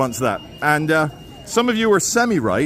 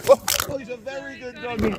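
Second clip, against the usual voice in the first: doggy talk; 2 semitones lower than usual. Real, not acted. Praising the owner's dog.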